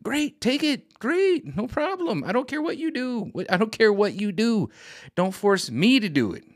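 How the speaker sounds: very high, singsong mock approval; mock cheery. Telling the caller to go take it, in a mock high chirp.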